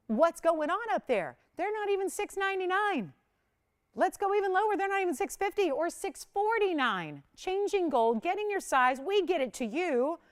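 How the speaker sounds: high, loud, excited sales pitch; excited hype. Real, not acted. Host thrilled to viewers as prices keep falling, high excited pitch. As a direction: sell the price drop with rising thrill.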